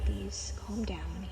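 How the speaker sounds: soft, slow whisper; hushed plea. Acted. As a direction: whisper it to settle him down. Calming Ivorde, hushed aside.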